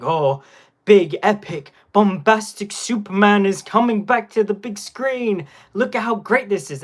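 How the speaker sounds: hammed-up epic movie hype; bombastic trailer hype. YouTuber mimicking earlier Superman trailers, bombastic hype. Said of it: "they were all just like".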